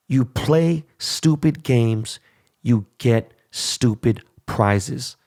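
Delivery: very slow, each word spaced out; slow warning. Real, not acted. Scolding the rioters, slow deliberate warning. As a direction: spell out the proverb slowly like a warning.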